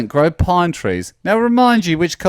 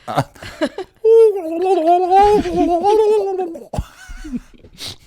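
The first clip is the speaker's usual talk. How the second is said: high warbling magpie call; chattering magpie voice. Acted. Dale does magpie call.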